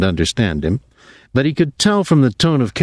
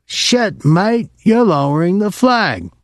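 high, scornful sailor grumble; disgusted; 10 semitones higher, slower than usual. A narrator's reading. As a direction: spit it out in disgust. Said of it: "Pinky said disgustedly".

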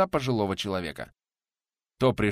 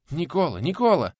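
lightly voiced anxious woman, higher pitch; a hint of a worried peasant woman; worried woman calling; 7 semitones higher, 4 dB louder than usual. A narrator's reading. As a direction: call his name in alarm, raised pitch. Alarmed Matryona calling to the hero.

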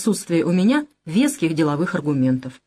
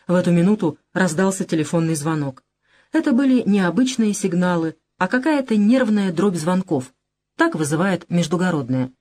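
plain reading voice

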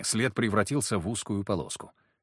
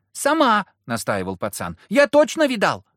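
put-on much higher, louder child insisting; insistent little boy; 10 semitones higher, 7 dB louder than usual. A narrator's reading. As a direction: stubbornly insist like a small kid. Small boy insists, voiced high.

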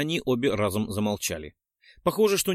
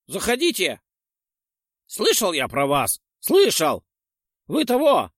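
put-on high, louder squeaky old man voice; 8 semitones higher, 5 dB louder than usual. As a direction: chatter like a fussy old man.

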